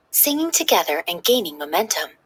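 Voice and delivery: ordinary delivery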